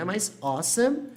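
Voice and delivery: "awesome" drawn out slowly, AW-sum; pronunciation demo. Shown as a demonstration. Teacher showing how "awesome" is pronounced. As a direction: stretch "awesome" so they hear it. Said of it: "que é pronunciado assim".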